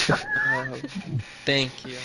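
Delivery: sarcastic, grudging thanks; eye-roll thank you. Acted. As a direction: say thanks while rolling your eyes. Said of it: "She rolls her eyes as she says thank you".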